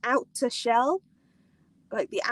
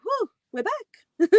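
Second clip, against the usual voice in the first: mock surprise; 9 semitones higher, slower than usual. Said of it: "It's like".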